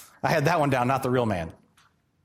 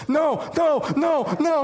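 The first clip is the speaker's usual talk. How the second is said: embarrassed refusal; 9 semitones higher, slower than usual. Retold or imitated. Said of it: "I'm like".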